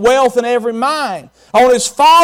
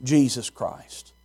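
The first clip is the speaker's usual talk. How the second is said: reverent confession; 6 semitones lower, much slower than usual. Acted. Peter confessing to Jesus, low and reverent. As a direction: breathe it out in reverence. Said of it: "He says".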